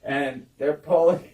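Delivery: ordinary delivery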